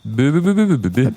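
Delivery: syllabes be à la place des mots; parler en bebebe. Pretend. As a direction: ne parler qu'en be be be. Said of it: "tu peux parler en bebebe". (ne dire que des be)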